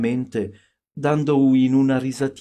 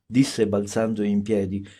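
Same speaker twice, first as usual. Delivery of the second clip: ordinary delivery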